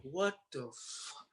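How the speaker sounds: soft, slow, dazed disbelief; quiet disbelief. Retold or imitated. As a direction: breathe it out, dazed. On a plane to Florida.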